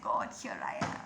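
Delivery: hushed, breathless whisper to God; whispered prayer. Retold or imitated. Preacher retelling her whispered surrender to God, hushed.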